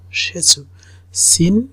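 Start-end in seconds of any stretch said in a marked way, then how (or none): 0.1-0.6 সে সু whispered, split into two syllables. Shown as a demonstration. Teacher whispering সে সু for the class. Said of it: "উচ্চারণটা".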